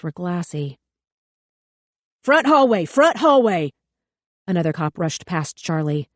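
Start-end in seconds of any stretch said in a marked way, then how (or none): 2.2-3.7 loud, higher shouted callout; calling out. A narrator's reading. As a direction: call it across the hall. Cop calling out location.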